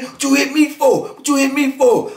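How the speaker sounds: high, pushed-up mock demand from the big guy; imitating a mad challenge. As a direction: throw it back like he's accusing you. Cellmate challenging him mid-fight.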